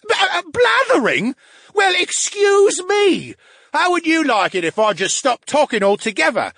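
high, loud, huffy brain voice; wounded pride, mocking back; offended brain. AB offended, mock sulk.

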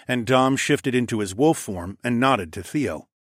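plain reading voice

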